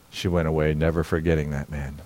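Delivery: ordinary delivery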